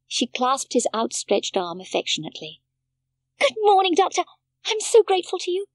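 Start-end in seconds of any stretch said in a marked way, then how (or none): 3.4-5.7 put-on high, loud, quick child's welcome; eager greeting. A narrator's reading. Heidi greets the doctor eagerly, high and quick. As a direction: throw your thanks at him.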